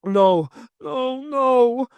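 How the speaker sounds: a husband screaming as his wife leaves him. Acted. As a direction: scream it in despair. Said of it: "Kirkhope screamed".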